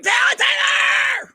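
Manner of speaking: high, slow, drawn-out shout of the name; excited calling out. Retold or imitated. Fan spotting TikTok Tyler, shouting his name excitedly. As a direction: call him across the stands, thrilled. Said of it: "I was just like".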